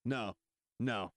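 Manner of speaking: ordinary delivery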